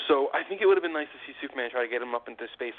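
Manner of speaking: normal talking voice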